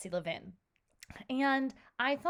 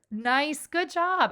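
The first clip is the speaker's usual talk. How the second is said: bright praise; 6 semitones higher, 5 dB louder, slower than usual. Real, not acted. Teacher praises the class.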